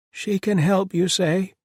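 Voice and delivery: high, loud, pitched well above the narration; older woman's question. A narrator's reading. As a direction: ask it high and eager. Older woman asks in hope.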